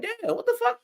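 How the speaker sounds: a high, hushed "what the fuck" of shock; stunned disbelief. Retold or imitated. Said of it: "I'm like".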